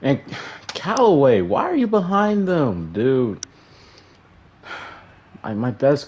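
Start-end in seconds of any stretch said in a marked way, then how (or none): 0.0-3.5 high, loud scolding of a player on screen; real frustrated shout, much higher; exasperated yell. Real, not acted. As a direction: yell at the cornerback who blew coverage. Scolding his cornerback on screen.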